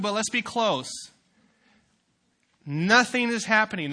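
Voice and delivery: loud, high preaching voice; full-force preaching. Acted. Youth pastor preaching about salt to the congregation, loud and high. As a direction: drive the point home with power.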